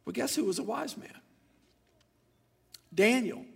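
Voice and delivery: ordinary talk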